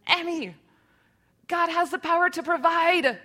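high, loud cartoon duck proclamation; little kid shouting in a duck voice; Looney Tunes duck. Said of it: "he talks like this because he was talking like the duck".

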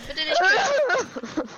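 slow loud high whining plea; drawn-out pleading. Real, not acted. Begging not to be killed.

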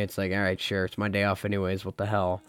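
ordinary delivery

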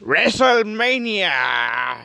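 booming wrestling announcer promo; big ring announcer hype voice; dramatic reality show hype. Acted. Wrestling event promo intro.

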